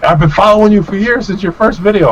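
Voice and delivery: high-pitched, put-on indignation; imitated upset fan. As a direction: protest like a snubbed follower. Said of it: "He was, like, upset".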